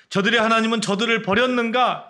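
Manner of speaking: mock them as abandoned; scornful taunt. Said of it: "이렇게 이야기를 했습니다".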